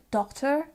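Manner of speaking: "doctor" as a short-o "dot-er"; short vowel and sounded final r in "doctor"; another accent. Shown as a demonstration. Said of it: "this could also sound like".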